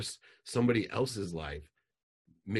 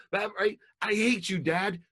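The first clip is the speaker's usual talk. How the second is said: put-on much higher, loud teen voice; whiny daughter; 13 semitones higher, 6 dB louder than usual. Daughter woken by angry dad, high and whiny. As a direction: answer like a defensive teenage girl. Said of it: "imagine if I would have called".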